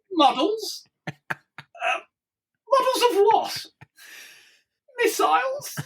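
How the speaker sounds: very high, hesitant squeak; halting, high-pitched mock unease; sheepish admission. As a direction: re-enact answering gingerly, with long pauses. Retold dread of admitting the missiles.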